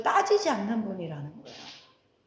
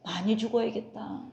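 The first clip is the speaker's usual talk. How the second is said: dry quoted rebuke; 3 semitones lower, 7 dB quieter than usual. Retold or imitated. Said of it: "목사님도 날 보더니 뭐랬는지 알아요?".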